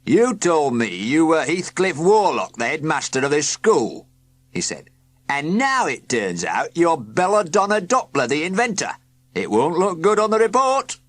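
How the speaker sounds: high, loud, stern official voice; stern inspector. A narrator's reading. Inspector reproaching Heathcliff sternly and loud. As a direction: scold him like a disappointed inspector. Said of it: "very sternly".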